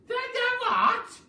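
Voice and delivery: very high, loud, slow stammering shriek; stammering wail of fright; terrified scream. Acted. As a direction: shriek the question in horror. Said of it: "screamed Missus Twit".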